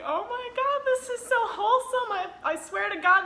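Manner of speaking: high-pitched, teary-style fangirl gush; mock overwhelmed gushing. Retelling tearing up at a wholesome moment, high overwhelmed gushing. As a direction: gush as if moved beyond coping. Retelling being moved to tears.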